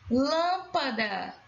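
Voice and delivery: "Lâmpada" slow, first syllable stretched. Shown as a demonstration. Teacher asking for the stressed syllable, "Lâmpada" slowly sounded out. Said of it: "Qual é a sílaba tônica?".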